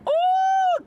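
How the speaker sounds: loud high ooh of surprise; sudden startled shout of ooh; surprised ooh. Real, not acted. Surprised exclamation while driving.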